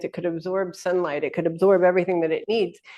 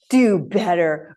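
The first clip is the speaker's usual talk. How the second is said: slow, smug "Do better"; drawn-out condescending quip; snarky; slower than usual. Retold or imitated. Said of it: "that sort of".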